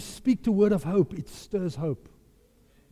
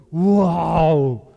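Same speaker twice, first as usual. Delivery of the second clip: loud, low, drawn-out wow; big low "wow" of wonder; acted awe; 4 semitones lower, 5 dB louder than usual. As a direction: stretch out an amazed wow. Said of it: "my soul goes".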